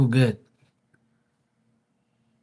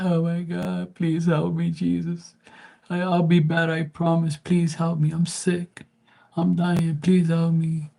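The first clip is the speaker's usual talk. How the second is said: high, pleading mock voice of a sick sinner begging Jesus; mock desperate prayer; 9 semitones higher than usual. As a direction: whine it up high like someone on their deathbed. Mocking a dying person's prayer.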